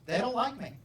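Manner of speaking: high, loud mock kid's complaint; protesting child. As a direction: protest like an aggrieved student. Child's excuse, acted back.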